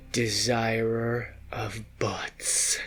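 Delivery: breathy sinister whisper. Acted. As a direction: hiss the title slowly. Stranger's dramatic title.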